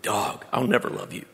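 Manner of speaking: low, soft resigned aside; mock defeated aside. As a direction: drop low and quiet, as if giving up. His defeated thought, acted.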